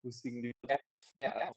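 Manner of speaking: choppy, cutting out connection; breaking up. Real, not acted. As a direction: voice dropping out over bad line. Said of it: "suaranya putus-putus".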